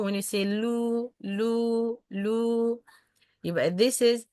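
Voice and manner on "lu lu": lu with the dhamma rounded and pushed too hard; wrong way: press the lips on lu; overstressed dhamma. Shown as a demonstration. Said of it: "emphasize the dhamma". (ضمة مبالغ فيها على lu)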